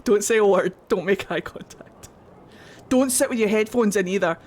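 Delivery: ordinary talk, nothing marked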